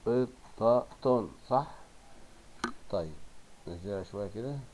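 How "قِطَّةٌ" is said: "قطة" split as "qit... ta... tun"; slow, syllable-by-syllable قطة; tanween damma ending. Shown as a demonstration. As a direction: break قطة into three beats ending -tun. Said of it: "الكلمات التالية بالضم".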